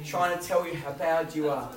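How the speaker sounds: normal talking voice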